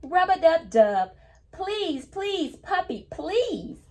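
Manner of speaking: high, sing-song pleading read-aloud; storybook reading voice. Acted. Teacher reading the picture book to kids, high sing-song plea. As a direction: read the line like a child calling her puppy. Teacher reads book's bath line.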